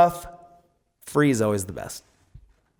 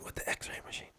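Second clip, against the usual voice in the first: unvoiced groggy whisper; 21 dB quieter, faster than usual. Real, not acted. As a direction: whisper the words, barely there. Whispered original.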